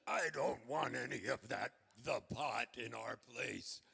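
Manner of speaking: voix plus basse d'un père qui refuse; low, dragging grumble of an objecting dad; scared dad. Acted.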